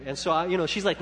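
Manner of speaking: normal talking voice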